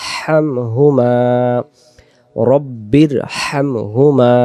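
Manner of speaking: হামহুমা drawn out slowly with a breathy h; slow correct recitation. Shown as a demonstration. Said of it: "গলাটা একটু শোষ হবে".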